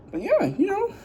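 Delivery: a comic squeal; squeaky silly voice. Pretend. Sudden squeaky voice.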